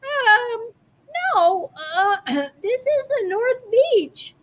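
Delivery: put-on a little boy unsure of himself; boy's hesitant voice. A narrator's reading. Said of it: "I said".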